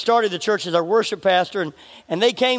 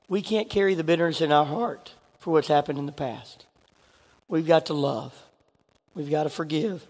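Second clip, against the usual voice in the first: earnest softening; 5 semitones lower, 5 dB quieter than usual. Real, not acted. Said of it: "tender sermon appeal".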